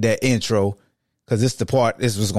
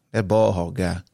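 normal talking voice

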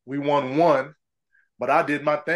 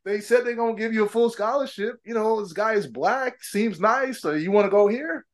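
mom voiced; 7 semitones higher than usual. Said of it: "my mom was just like".

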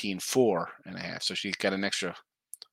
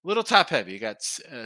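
ordinary delivery

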